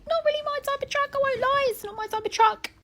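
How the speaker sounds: high put-on snooty voice, nose in the air; mock snooty voice. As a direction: turn your nose up at it in a high posh voice. Mock posh voice judging truck.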